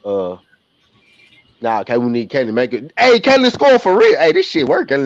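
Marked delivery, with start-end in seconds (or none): 3.0-5.1 high, loud surprised whoop; excited shout. Real, not acted. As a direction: yell as the call comes true. Bet on player succeeds.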